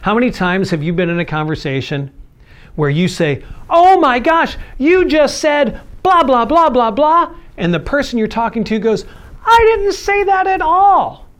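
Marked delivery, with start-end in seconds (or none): 3.7-7.3 much higher and louder, hammed-up exasperated accuser; mock accusation. Acted heated conversation quote. 9.4-11.3 much higher and louder, slow and outraged; mock indignant denial. Other person denies in acted quarrel.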